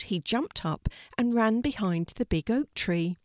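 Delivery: ordinary delivery, nothing marked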